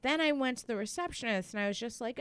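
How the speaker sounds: ordinary talk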